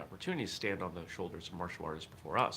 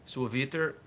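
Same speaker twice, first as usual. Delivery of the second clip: Latin read with a French accent, raised and louder; French-tinged attempt at Latin, ending on a rise; unintended French accent; 6 semitones higher, 7 dB louder than usual. Real, not acted. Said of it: "like, a French accent".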